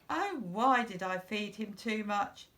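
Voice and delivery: ordinary delivery, nothing marked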